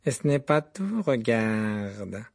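taunting Malfoy. A narrator's reading. Malfoy showing off his badge.

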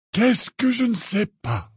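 put-on high, slow, even old man's question; calm. A narrator's reading. Dumbledore questioning a furious Harry, calm. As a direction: ask it patiently, unruffled. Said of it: "demanda Dumbledore de la même voix calme".

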